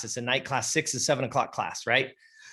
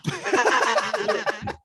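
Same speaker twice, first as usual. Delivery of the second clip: high laugh; 9 semitones higher than usual. Real, not acted. Laugh after goal.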